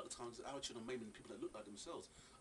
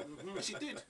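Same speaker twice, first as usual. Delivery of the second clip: ordinary talk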